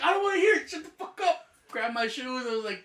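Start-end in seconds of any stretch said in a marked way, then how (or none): 0.0-1.4 much higher and louder hammed-up yell; mock angry shout. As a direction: shout him down, refusing to listen. Angrily cutting off friend's excuse.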